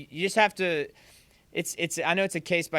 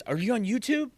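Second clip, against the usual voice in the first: higher-pitched mock kid question; imitated eager student; 7 semitones higher than usual. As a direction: ask it like a curious student, voice lifting.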